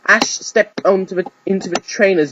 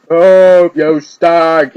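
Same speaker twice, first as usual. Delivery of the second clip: much louder heckling call; yelled; 9 dB louder, much slower than usual. Acted. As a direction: shout across the stadium. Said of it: "yelled the spectators".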